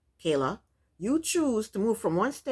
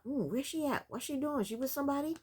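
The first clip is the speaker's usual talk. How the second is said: softer, higher mock fretting; mock worried; 5 semitones higher, 8 dB quieter than usual. Vlogger imitating Martell worrying where Kayla is, soft mock fret. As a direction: play the anxious boyfriend wondering, hushed. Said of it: "she wants him worried".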